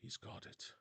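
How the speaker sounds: whispered coach. Retold or imitated. Happy coach watching the boy win, whispered. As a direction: whisper it with quiet delight. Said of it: "He's like".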